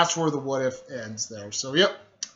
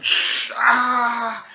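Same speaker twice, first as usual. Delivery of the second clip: whoosh into high, loud yell; imitated attack yell; 9 semitones higher, 7 dB louder than usual. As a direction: make the blast noise, then a long "ah".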